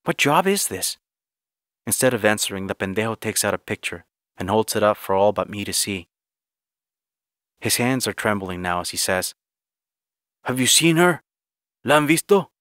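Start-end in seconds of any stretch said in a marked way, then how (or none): 0.0-1.0 much higher, insistent question; indignant demand. Acted. Narrator demands answers from Johnny. 10.4-12.5 higher, louder, pressing question; urgent questioning. Acted. Johnny anxiously questions migrants with photo.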